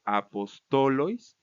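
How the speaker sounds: very slow "apostolois", stress on TO; penultimate stress. Shown as a demonstration. Teacher showing plural dative, slow stress on TO in "apostolois". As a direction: stretch "apostolois" out, leaning on TO. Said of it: "se va a cambiar a la penúltima sílaba".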